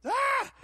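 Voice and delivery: high, loud, sustained yell; sudden drawn-out holler; imitated scream. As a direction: let out a long mock scream into the mic. Imitating Bob Jones at microphone.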